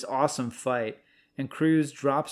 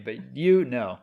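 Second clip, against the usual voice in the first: usual speaking voice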